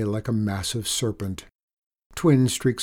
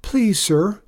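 higher, louder, nervous stammer; boy apologizing to the angry engineer; stammered apology; 9 semitones higher, 7 dB louder than usual. A narrator's reading. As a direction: stumble out a frightened apology. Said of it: "he stammered".

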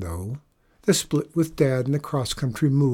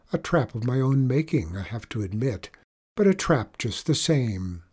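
usual speaking voice

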